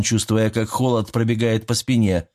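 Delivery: usual speaking voice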